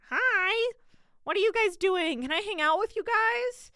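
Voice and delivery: put-on high, loud chirpy kid sister; squeaky bright little-sister voice.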